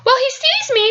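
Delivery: usual speaking voice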